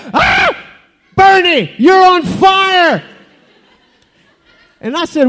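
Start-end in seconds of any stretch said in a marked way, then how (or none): 0.1-0.6 very high startled "ah"; startled yell. Acted. Friend spots the bush on fire, startled yell. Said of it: "he looked at me and said". 1.2-3.0 high, drawn-out cry of alarm; alarmed shout. Acted. Friend warns Bernie of the fire, alarmed shout.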